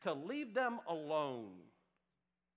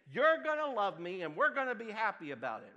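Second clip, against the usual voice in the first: loud, forceful demand as a controlling parent; mock angry parent; 4 semitones higher, 10 dB louder than usual. Pastor voicing an angry parent to a rejecting child, loud mock demand. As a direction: shout it at the captive child like a furious parent. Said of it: "and say".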